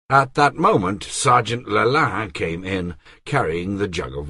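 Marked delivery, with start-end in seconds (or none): none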